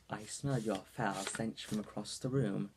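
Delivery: a slow put-on British accent; slow, mock-posh British delivery. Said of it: "she just sounds like she's a little bit British".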